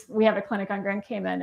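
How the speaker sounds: ordinary delivery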